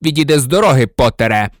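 loud, heated exchange; forceful, angry outburst; angry shouting. A narrator's reading. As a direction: raise your voice in fury. Furious confrontation blocking the door.

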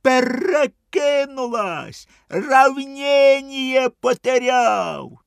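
high, slow, loud panicked yelling; panicked shout. A narrator's reading. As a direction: yell from the water, losing balance. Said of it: "крик з озера". (панічний крик з води)

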